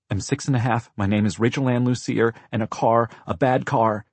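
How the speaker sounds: put-on six-year-old's urgent explanation; insistent little girl. A narrator's reading. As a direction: plead to be believed.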